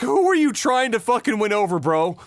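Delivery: ordinary talk, nothing marked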